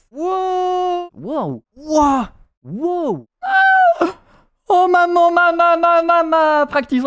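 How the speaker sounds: loud high scream for mom. Real, not acted. Streamer screaming in panic at the game, loud and high. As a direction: cry out in panic.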